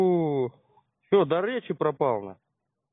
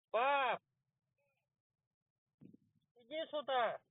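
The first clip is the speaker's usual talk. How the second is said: calling out; 6 semitones higher, 13 dB quieter, slower than usual. Pretend. Calling father to the phone.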